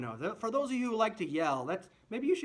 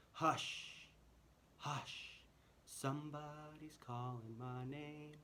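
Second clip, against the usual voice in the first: very soft, breathy piano; whispered; 3 semitones lower, 14 dB quieter, much slower than usual. Shown as a demonstration. Teacher recapping the whispered first verse. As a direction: barely voice the hush. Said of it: "Whisper, piano".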